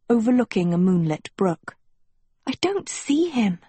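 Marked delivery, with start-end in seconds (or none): 2.5-3.6 whisper. A narrator's reading. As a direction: whisper it nervously into an ear. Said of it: "she whispered".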